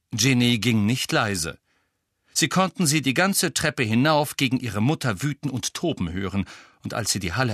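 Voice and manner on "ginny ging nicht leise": ordinary delivery, nothing marked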